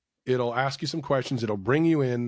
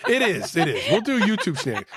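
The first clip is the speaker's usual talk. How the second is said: high giggling reply; laughing; 5 semitones higher, 4 dB louder than usual. Real, not acted. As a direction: laugh while answering.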